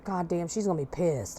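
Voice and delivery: low, soft, under the breath; muttered worry. Retold or imitated. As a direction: grumble it quietly. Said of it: "So he was like".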